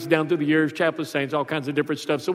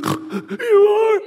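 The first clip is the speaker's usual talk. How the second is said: put-on high, small child's answer; small boy; 4 dB louder than usual. Son answering his dad's question in a high child voice. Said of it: "He goes".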